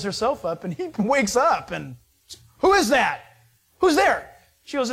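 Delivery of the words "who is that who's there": louder startled who's-there, pitched up; startled call. Retold or imitated. Boaz woken at night calls out, startled and loud.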